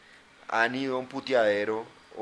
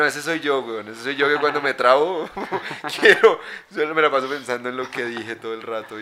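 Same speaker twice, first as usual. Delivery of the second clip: riendo a carcajadas; 9 dB louder than usual. Retold or imitated. Retells his own reaction, laughing.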